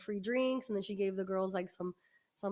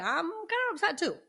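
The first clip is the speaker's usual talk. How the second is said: mock upset too; 6 semitones higher than usual. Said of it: "And I was like".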